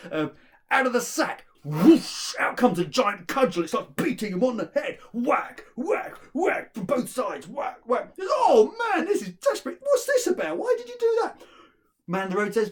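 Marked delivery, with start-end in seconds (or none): 0.7-1.5 high, loud magic command; shouted command. Acted. Son tries out the magic sack, shouted command. 1.6-8.1 loud, high, punchy storytelling; excited action narration. A narrator's reading. Narrator tells of the cudgel's attack, excited and loud. 8.2-11.4 very high, loud protest; acted alarm. Son beaten by the cudgel, high alarmed protest.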